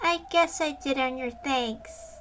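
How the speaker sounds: put-on low, soft, shy girl's voice; soft and quiet. Une leads them through the window, soft and quiet. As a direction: keep it hushed and shy. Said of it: "make it kind of soft and quiet".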